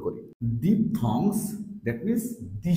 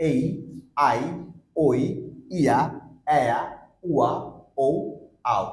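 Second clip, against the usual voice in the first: এই, আই, ওই, ইয়া, এয়া, উয়া, ও, আও said quicker, less gap; faster run-through; 3 dB louder than usual. Shown as a demonstration. Teacher repeating all diphthongs a bit faster. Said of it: "একটু fast bowler try করবো".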